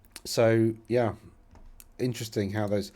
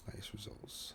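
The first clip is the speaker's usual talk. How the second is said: latest results whispered under his breath; 20 dB quieter than usual. Real, not acted. Host reading his screen to himself, whispering. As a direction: murmur it to yourself, voiceless.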